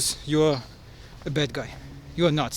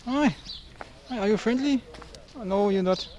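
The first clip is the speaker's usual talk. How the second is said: higher and softer, coaxing an animal; talking to an animal; 4 semitones higher, 7 dB quieter than usual. Real, not acted. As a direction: gently coax a frightened creature. Greeting a frightened animal.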